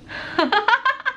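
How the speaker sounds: high giggle; showcase laugh. Shown as a demonstration. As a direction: laugh on cue. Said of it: "and my laugh".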